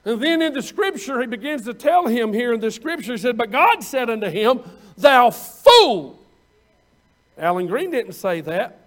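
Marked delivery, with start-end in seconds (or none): 3.4-6.2 loud stern "Thou fool"; God's rebuke. Retold or imitated. Preacher voicing God rebuking the rich man, loud and stern. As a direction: thunder the rebuke.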